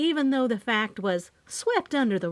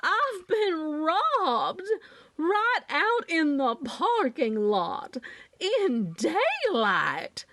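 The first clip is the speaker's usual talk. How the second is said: high, drawn-out Southern wail; hammed-up Southern lady in distress; very Southern accent; 8 semitones higher, much slower than usual. A narrator's reading. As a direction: stretch every word in outraged Southern melodrama. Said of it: "screamed in her very southern accent".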